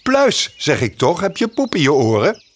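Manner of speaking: high, impatient bird voice; a cheeky bird losing patience; exasperated bird. Acted. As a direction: scold the dim bear. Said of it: "riep Gniffel".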